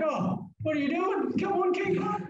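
high-pitched exasperated call to a teammate; exasperated urging. Retold or imitated. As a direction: urge him on, half laughing. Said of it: "and I'm like".

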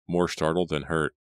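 ordinary delivery

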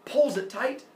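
ordinary delivery, nothing marked

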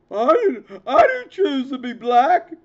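high, loud, whiny mock sob; loud hammed-up crying protest; mock crying whine. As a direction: imitate him whimpering like a wounded victim. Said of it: "Like that dude said".

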